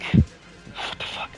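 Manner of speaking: breathy, voiceless whisper of disbelief; stunned whisper. Real, not acted. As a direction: mutter it under your breath, stunned. Shocked aside during a title list.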